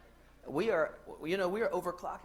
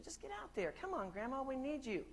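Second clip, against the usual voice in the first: high, soft acted plea; gentle coaxing; 7 semitones higher, 5 dB quieter than usual. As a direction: coax an older relative in a small, high voice.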